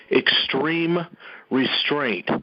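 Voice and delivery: extreme, a firm pause, then restraint; emphatic repeat. Real, not acted. Third repeat to the militia, emphatic pause between extreme and restraint. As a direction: split extreme restraint into two weighty words. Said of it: "gonna repeat that a third time".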